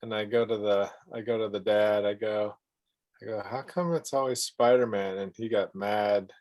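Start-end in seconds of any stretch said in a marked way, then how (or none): none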